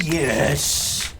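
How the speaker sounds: a quick cry of victory; triumphant yes. Real, not acted. Streamer cheering the new tech, triumphant. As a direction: celebrate the unlock. Game unlocks industrial mining.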